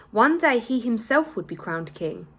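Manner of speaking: ordinary delivery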